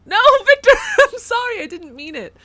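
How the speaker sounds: high, loud pleading cry; frantic apology. Real, not acted. Streamer apologising to Victor, loud and frantic. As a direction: call out a panicked apology.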